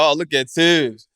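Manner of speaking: high, loud teasing crack; mocking tease. Player teasing the nervous coach in front of the team, loud high mockery. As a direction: roast him loudly for the whole gym.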